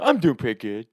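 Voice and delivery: lightly put-on cartoon character voice; reply as the cartoon character; a hint of cartoon boy voice; Jimmy from South Park. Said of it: "Jimmy character from South Park voice".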